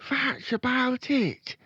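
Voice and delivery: put-on high, loud, drawn-out little-boy voice; high-pitched boy character; young Fred. A narrator's reading.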